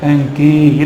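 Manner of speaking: "Hengki" with ng, drawn out; Madurese word attempt. Shown as a demonstration. Preacher giving up on Madurese lesson, stretched "Hengki". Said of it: "Harus kursus bahasa Madura".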